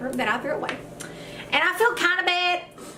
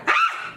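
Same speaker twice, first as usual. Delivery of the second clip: short high-pitched mock shriek; quick "Ah!" leaping up high; startled shriek. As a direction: act out a sudden fright. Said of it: "I was like".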